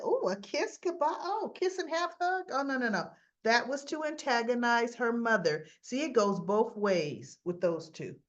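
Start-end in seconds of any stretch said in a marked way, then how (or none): 0.0-3.1 mocking. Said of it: "I said".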